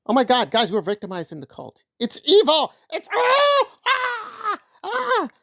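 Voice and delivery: hammed-up high shrieking mock distress; mock victim wailing. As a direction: ham up a fake victim's wail. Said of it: "they're very good at play acting".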